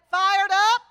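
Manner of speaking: called out like a preacher; rallying call to the congregation; minister's call. Retold or imitated. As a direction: minister calling to the congregation, rising. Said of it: "he would say".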